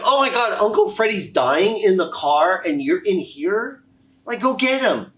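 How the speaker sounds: loud, high, exasperated outburst; hammed-up alarm, pitch soaring; urgent disbelief. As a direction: exclaim in shock, then order them out. Retelling his urgent order to family.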